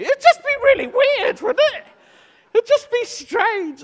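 high, loud, squirming voice of embarrassment; mock awkward dread. Preacher voicing his own cringing thought, high and loud. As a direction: ham up how weird it would feel.

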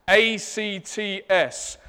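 "A C T S" spelled letter by letter, slowly. Shown as a demonstration. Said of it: "my Leicester accent".

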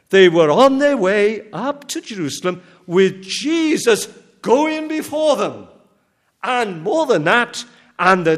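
loud, driving sermon voice; rising pulpit fervour; forceful preaching. Acted. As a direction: preach it out to the congregation.

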